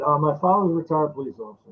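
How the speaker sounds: ordinary delivery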